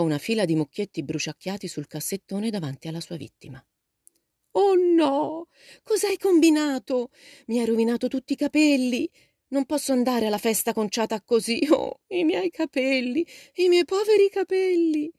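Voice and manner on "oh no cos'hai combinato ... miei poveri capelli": wailing moan. A narrator's reading. Meg to her sister over her ruined hair, wailing. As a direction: moan it loud and high, stretching the cries. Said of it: "gemette Meg".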